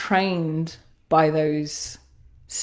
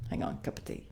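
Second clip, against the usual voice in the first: whispered aside; 15 dB quieter, faster than usual. Real, not acted. Aside while reaching for tea.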